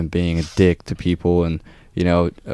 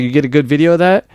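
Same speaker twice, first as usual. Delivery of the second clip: high, fast sarcastic jab; sneering taunt at the guy filming; mocking taunt; 7 semitones higher, 3 dB louder, faster than usual. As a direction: needle him about filming. Mocking the man filming him.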